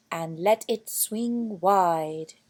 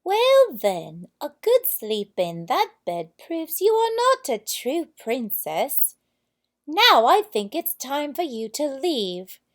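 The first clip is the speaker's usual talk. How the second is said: stern queen; 6 semitones higher, 8 dB louder than usual. A narrator's reading. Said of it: "asked the queen".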